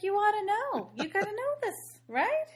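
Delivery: a hammed-up, high, pleading tone; mock eager heroine. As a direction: sweetly insist so he believes it. Imitating heroine eagerly convincing him.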